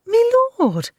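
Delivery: high, loud frightened whimper. Acted. Maid whimpers to her angry lord. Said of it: "Hinkle whimpered".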